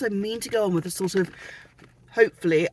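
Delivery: ordinary talk, nothing marked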